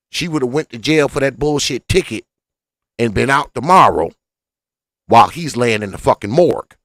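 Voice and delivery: normal talking voice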